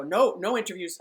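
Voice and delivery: loud, clipped brush-off; curt refusal. Retold or imitated. Player waving off a reporter in the locker room, loud curt refusal. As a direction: shut the reporter down fast and loud. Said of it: "he would immediately see me and go".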